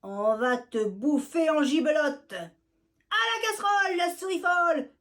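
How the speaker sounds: loud, high threatening yell; wicked cats shouting at the mouse. Acted. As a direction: bellow the threat. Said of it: "crient les méchants chats".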